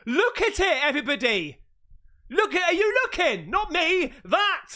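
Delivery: high loud excited pointing; excited shouting. Real, not acted. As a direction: shout at everyone to look.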